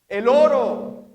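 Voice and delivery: high, loud, the gold; loud punchline. Real, not acted. Preacher revealing the answer to the congregation, loud and high. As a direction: hit the answer hard.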